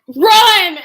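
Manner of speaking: high, loud retold alarm; urgent shout. Telling her friend to flee the twins, urgent shout. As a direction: yell it like a getaway signal.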